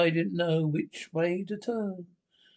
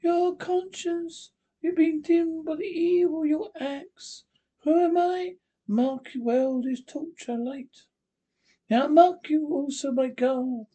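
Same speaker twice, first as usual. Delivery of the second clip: witch voice; 10 semitones higher than usual. Acted. Witch Hester mocking the judge, high eerie witch voice. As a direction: sneer at him like a ghostly witch.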